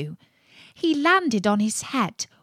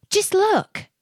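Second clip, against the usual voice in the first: much higher, louder eager cry; excited boy's cry; 12 semitones higher, 4 dB louder than usual. Acted. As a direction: point it out with glee. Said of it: "cried one".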